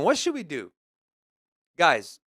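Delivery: normal talking voice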